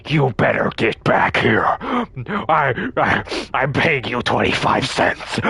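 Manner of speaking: odd, off-kilter voice of a hunched stranger; creepy store man. Retold or imitated. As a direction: sound like something not quite human. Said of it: "and he was like".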